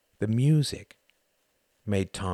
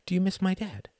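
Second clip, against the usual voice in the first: put-on child asking; 11 semitones higher than usual. A narrator's reading. Jocelyn asking.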